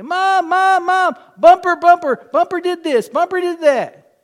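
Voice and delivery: high, loud whining little brother calling for mom; whiny tattling kid. Retold or imitated. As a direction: tattle on Bumper to mom in a whine. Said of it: "he'd run in the house and say".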